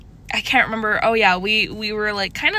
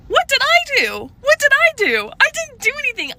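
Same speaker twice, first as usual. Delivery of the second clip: much higher, louder bewildered protest; reading his text reply, in shrill baffled disbelief; exasperated disbelief; 4 dB louder than usual. Retold or imitated. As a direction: squeal it in outraged confusion.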